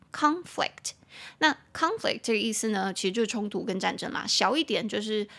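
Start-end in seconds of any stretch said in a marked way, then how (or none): 0.1-0.9 "conflict" with the first syllable "con" raised and stressed. Shown as a demonstration. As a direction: hit "con" in "conflict", let "flict" fall away. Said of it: "重音节在第一音节".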